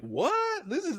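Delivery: a sudden high, bewildered what; surprised what. Retold or imitated. Daughter caught off guard by dad, a sudden high surprised what. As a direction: catch her completely off guard.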